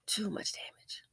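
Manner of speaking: hushed breathy whisper, no voice. Shown as a demonstration. Whispering the verdict to viewers. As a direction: drop to a bare whisper on too much damage.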